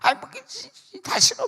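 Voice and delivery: high-pitched mock irritable patient; dying patient's anger. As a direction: mimic a sick patient snapping. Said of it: "내가 그랬더니".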